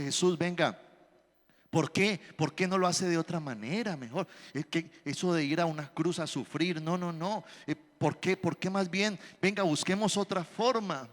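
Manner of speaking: low-key, half-whispered pleading; soft pleading protest. Retold or imitated. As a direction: Peter gently arguing Jesus out of it. Pedro rebukes Jesus, pleading.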